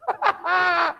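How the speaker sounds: high burst of laughter; sudden high cackle of amusement; hearty laugh. Real, not acted. Amused at a name in class.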